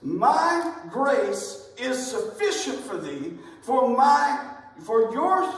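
fumbled quote. Real, not acted. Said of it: "let me say it right".

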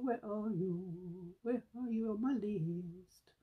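slow, stretched calling for the missing item; drawn-out coaxing. Real, not acted. Vlogger coaxing a missing item on her list, slow and drawn out. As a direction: coax it out slowly, like calling a pet.

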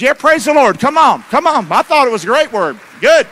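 loud, high, rousing praise; fired-up preaching. Real, not acted. Preacher rousing the congregation after the message, loud praise. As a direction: lift the room with a loud hallelujah shout.

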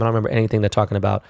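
ordinary delivery

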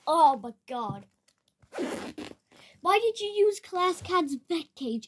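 ordinary delivery

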